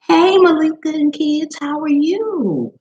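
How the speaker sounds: high, slow, drawn-out hello; cheerful greeting. Real, not acted. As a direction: greet a friend arriving, delighted. Greeting arriving viewer.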